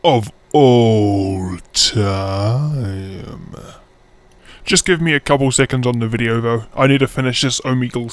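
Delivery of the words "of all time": slow, dramatic drawl on each word; OF ALL TIME, hugely elongated; drawn-out emphasis. Shown as a demonstration. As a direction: stretch every word out very long. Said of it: "Or should I say".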